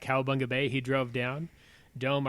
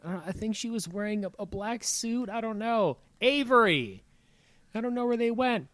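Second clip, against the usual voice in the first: put-on high, small voice of a lost kid; flustered little girl; 9 semitones higher than usual. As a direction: sound like a lost girl trying to explain. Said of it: "She's talking to the lifeguard".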